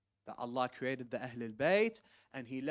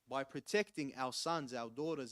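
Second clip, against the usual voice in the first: normal talking voice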